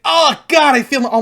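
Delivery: sudden high pained gasp; pained cry. Retold or imitated. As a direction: cry out in pain. Retold pain reaction.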